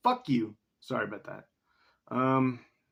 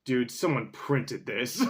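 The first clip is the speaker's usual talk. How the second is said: ordinary delivery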